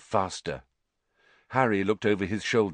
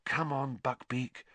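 soft, coaxing undertone; urgent murmur; 3 semitones higher, 9 dB quieter than usual. Acted. Harry coaxing Buckbeak to move, urgent murmur. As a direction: murmur it under your breath. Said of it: "Harry murmured".

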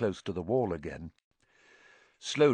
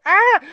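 high, piercing elf wail; loud high moan; 14 dB louder than usual. A narrator's reading. Dobby hearing Voldemort's name, loud horrified moan. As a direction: wail out in horror. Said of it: "and moaned".

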